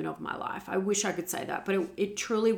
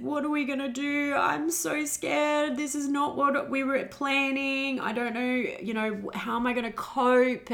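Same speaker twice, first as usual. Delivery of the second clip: crying and scared; 8 semitones higher than usual. Retold or imitated. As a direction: voice breaking with fear. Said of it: "I just cried and said".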